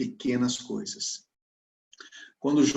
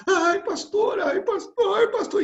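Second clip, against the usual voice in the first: high, loud mock crying wail; imitated wailing; 6 dB louder, slower than usual. Church sister phones her pastor in tears, imitated wailing. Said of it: "ela chorando, em prantos".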